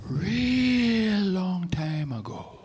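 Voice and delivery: very slow, drawn-out storytelling; storyteller voice. Shown as a demonstration. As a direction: savor every word like a storyteller. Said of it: "I gotta sound like an authentic storyteller".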